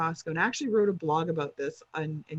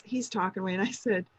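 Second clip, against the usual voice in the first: ordinary talk, nothing marked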